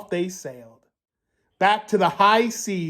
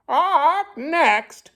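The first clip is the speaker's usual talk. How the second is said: high parrot squawk on both words; 11 semitones higher than usual. Acted. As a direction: screech it out like a parrot. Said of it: "squawked the bird".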